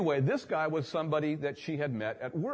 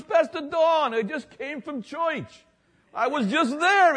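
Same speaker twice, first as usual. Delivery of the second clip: much higher, bubbly retold voice; eager man; 13 semitones higher, 7 dB louder, slower than usual. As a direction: gush like an excited fan. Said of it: "He goes".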